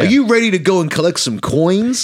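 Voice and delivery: high, loud, bland cheery Mario; mock a Mario with no accent at all; plain Chris Pratt Mario. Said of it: "Mario's gonna come out like".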